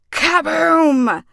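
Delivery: shouted high explosion word; big cartoon boom; loud kaboom. A narrator's reading. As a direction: blast it out like the oven exploding. Oven explodes in story.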